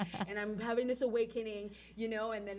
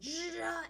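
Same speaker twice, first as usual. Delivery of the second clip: high, sudden imitated zap; lightning-strike noise; 10 semitones higher than usual. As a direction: imitate a bolt hitting you. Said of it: "struck by lightning. I was like".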